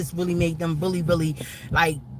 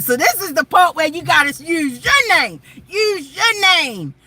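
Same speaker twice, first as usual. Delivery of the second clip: fervent shout; 10 semitones higher, 7 dB louder than usual. Retold or imitated. Retelling her ministering, shouted.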